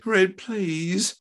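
high-pitched appeal; pleading. A narrator's reading. Wife appealing to her angry husband, pleading. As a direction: beg him to stop. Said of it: "Doris pleaded".

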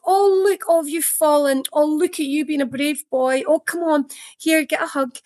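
fussing mum. Retold or imitated. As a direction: coo over the child like a doting mother. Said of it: "Mum says".

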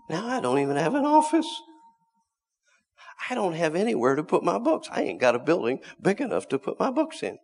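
loud, high preaching cry; rising pulpit shout. Real, not acted. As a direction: lift the voice and preach it out.

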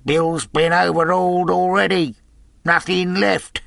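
loud sailor reporting; called-out report. A narrator's reading. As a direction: call the bad news across the road. Man calls news to Pew.

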